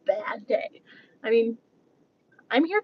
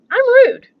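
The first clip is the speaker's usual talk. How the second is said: mock rude; 11 semitones higher, 5 dB louder than usual. Playing her rude self at checkout.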